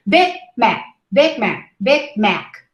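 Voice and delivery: Big Mac with g and c barely heard; unreleased final stops. Shown as a demonstration. Teacher models Big Mac as heard in context. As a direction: cut Big Mac short on each stop. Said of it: "you don't hear the final stop".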